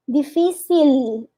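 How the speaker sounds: "difícil" slow, l held long; held final l. Shown as a demonstration. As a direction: linger on the l in "difícil". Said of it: "deixar um pouquinho de um tempo".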